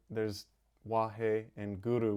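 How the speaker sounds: ordinary delivery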